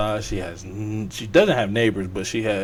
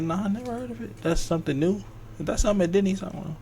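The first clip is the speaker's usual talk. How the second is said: mom's reply in a much higher voice; a dismissive mom, pitched way up; imitating mom; 9 semitones higher, 3 dB quieter, much faster than usual. Son asks mom about island.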